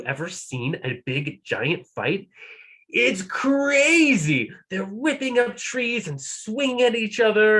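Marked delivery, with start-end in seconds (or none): 2.9-7.6 excited. A narrator's reading. Excited narration.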